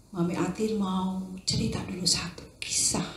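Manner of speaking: ordinary talk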